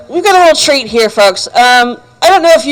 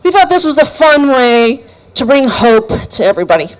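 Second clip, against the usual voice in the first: ordinary delivery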